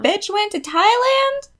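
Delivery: high, slow, indignant squeal; high indignant mock vent; mock outrage. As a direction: whine like a jealous friend. Mock venting about rich friends.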